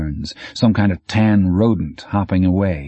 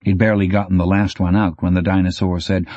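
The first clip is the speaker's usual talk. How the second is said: plain reading voice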